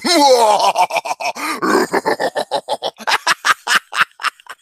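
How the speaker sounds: long mock-villain cackle; gloating laugh. Host taunting co-host over Joey, long gloating laugh.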